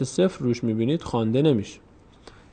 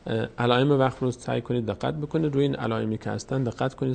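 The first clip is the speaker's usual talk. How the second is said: usual speaking voice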